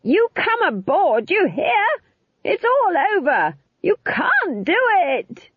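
very high, drawn-out shouted order; high-pitched, insistent shouting; urgent yell. A narrator's reading. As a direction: yell to be heard over roaring wind. Nanny yells back over the flames.

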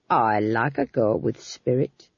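low, slow lecherous purr; leering. A narrator's reading. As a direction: leer it. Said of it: "he said".

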